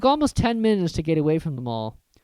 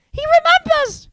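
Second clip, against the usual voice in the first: very high, loud delighted cry; cried out; 9 dB louder than usual. Acted. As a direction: squeal with joy. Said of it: "cried Daedalus Diggle".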